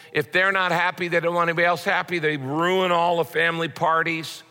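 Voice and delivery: ordinary talk, nothing marked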